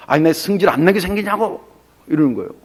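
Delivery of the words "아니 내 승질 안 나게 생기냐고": higher-pitched, indignant comeback; mock angry retort. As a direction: snap back as the sulking prophet, half-acted. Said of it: "왜 이렇게 승질 내냐".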